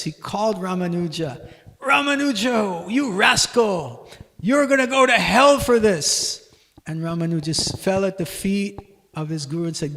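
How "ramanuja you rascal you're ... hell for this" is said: loud, higher angry rebuke; furious scolding. Retold or imitated. Guru scolding Ramanuja for sharing the mantra, furious loud rebuke. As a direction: scold the disciple in a rage. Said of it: "So the guru was furious".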